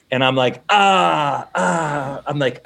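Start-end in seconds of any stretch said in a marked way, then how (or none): 0.7-2.2 fumbling. Retold or imitated. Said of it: "literally, like, fumbling".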